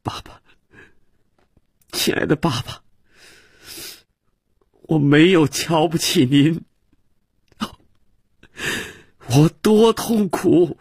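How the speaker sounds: put-on a boy weeping as he clings to his father; crying out. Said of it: "哭着叫道".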